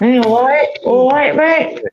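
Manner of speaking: a high, lightly put-on Cockney all right mate; Cockney greeting. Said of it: "that is how it would say in Cockney".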